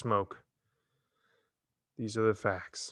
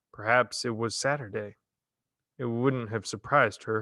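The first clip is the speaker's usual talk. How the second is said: ordinary delivery, nothing marked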